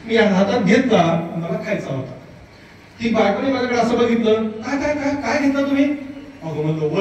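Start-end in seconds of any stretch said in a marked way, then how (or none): 4.3-5.8 mimicked wife, pitched up, rapid-fire questioning. Wife catches husband taking coconut from fridge, high mock questioning. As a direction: imitate a wife pouncing with higher-pitched questions.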